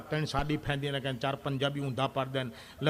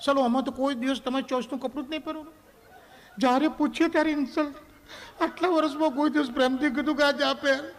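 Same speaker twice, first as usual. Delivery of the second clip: much higher put-on wife voice, complaining; offended wife; 9 semitones higher than usual. Wife scolds husband over saree choice, high offended whine.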